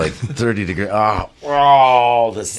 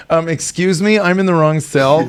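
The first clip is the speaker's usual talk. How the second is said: timid polite plea; 8 semitones higher than usual. Retold or imitated.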